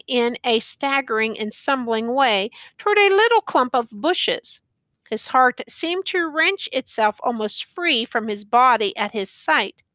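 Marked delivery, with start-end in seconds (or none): none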